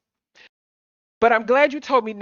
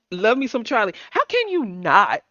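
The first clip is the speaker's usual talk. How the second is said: normal talking voice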